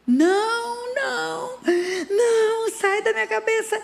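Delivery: very high, loud crying scream; child screaming. Retold or imitated. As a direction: wail it like a boy waking in terror, near tears. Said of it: "o menino acorda aos gritos". (grito agudo de criança, chorando)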